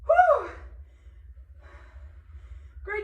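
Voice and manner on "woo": loud high whoop of effort; excited whoop. Real, not acted. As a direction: let out a big cheering woo. Coach cheers mid-exercise.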